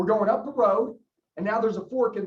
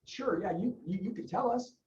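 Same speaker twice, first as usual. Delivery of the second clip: agreeable customer; 3 semitones lower, 8 dB quieter than usual. Acted. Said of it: "how many people would say".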